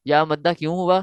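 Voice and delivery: normal talking voice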